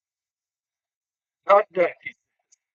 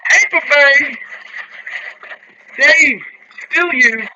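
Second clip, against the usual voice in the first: high, loud announcement of a repeat hit; excited call-out; 11 semitones higher, 8 dB louder, slower than usual. Real, not acted. Host telling Dave he has hit Tampa Bay, excited call-out. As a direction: sing out the win, loud and high. Calls out a second team hit.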